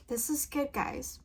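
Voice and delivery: trying to calm down after crying; real tearful, subdued voice; teary and soft. Real, not acted. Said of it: "let me calm down a bit".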